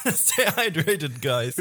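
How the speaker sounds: slow, laughing echo. Real, not acted. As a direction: laugh the words out slowly. Echoing partner through laughter.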